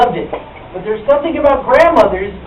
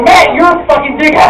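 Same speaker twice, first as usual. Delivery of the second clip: mock insult; 6 semitones higher, 6 dB louder than usual. Retorting at the tattoo artist.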